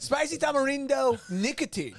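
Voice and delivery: mock Mexican accent on tamarindo; exaggerated Mexican accent. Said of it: "doing an offensive accent".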